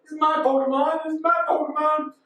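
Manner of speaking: high, hammed-up squeal; clamouring crowd. All the people at once, high hammed-up clamour. Acting out all the Pokemon trainers.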